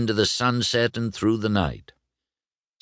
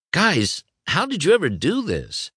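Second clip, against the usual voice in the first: high-pitched astonished question; amazed; 10 semitones higher than usual. Acted. Lightning to Rusty and Dusty, amazed. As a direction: ask it full of wonder.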